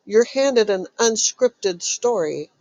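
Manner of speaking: usual speaking voice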